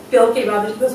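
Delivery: usual speaking voice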